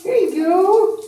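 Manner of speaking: chirpy pet-talk question. Real, not acted. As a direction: coo up high at the little pirate. Cooing to pet.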